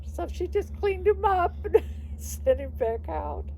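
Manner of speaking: laughing through the words; giggly, high and breaking up. Real, not acted. Laughing while telling funny story.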